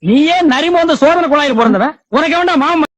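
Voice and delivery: loud high scolding; angry shout. Acted. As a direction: yell the insult, furious. Shouting insult at speaker.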